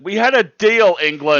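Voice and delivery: loud, high furious yell; a wife yelling at England over the weather; yelling at the sky. Retold or imitated. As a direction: shout up at the sky in outrage.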